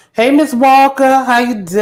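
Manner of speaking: high loud happy hello; excited greeting. Real, not acted. Host greeting Miss Walker on arrival, high and loud.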